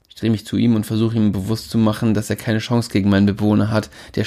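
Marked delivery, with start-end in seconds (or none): none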